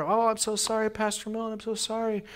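high-pitched mock-panicked apology; acted-out flustered pleading; frantic apology. Acted panicked apology to injured pastor.

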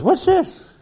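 high, squeaky mock disbelief; incredulous high voice. Preacher voicing a scoffing pilot, squeaky and incredulous. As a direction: pitch it way up like a scoffing old friend. Doc mimics friend's high questioning.

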